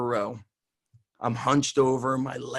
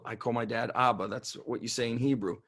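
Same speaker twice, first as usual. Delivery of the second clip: ordinary talk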